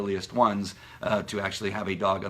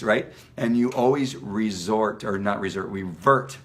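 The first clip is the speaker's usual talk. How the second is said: ordinary delivery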